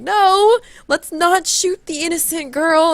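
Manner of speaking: high, drawn-out mock admonishment; alarmed guide. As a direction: slowly talk someone down in a high imitated voice. Retold guide stopping a shooting.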